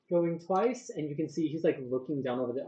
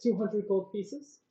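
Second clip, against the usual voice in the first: auctioneer; 3 semitones higher than usual. Acted. DM runs the auction.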